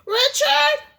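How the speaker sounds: high, loud calling out for her son; mother calling frantically for Richard; frantic call. Acted. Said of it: "My mother was calling frantically".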